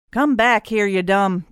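loud call after a runaway; angry yell. Acted. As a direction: yell after the escaping kid. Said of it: "Drake yelled".

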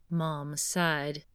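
usual speaking voice

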